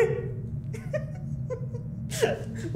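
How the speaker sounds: high breathless cackle; wheezing laugh. Real, not acted. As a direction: laugh till the voice goes. Laughing hard after win.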